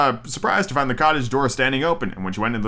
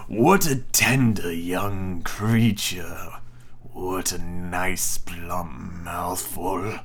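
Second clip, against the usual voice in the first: low, slow scheming wolf, fading to a whisper; sly wolf voice; 6 semitones lower, 4 dB quieter, much slower than usual. Acted. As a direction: purr it slowly like a hungry wolf. Wolf plots to himself.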